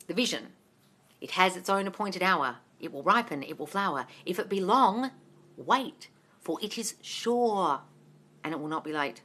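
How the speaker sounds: scripture recitation. Real, not acted. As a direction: recite a verse quietly and solemnly.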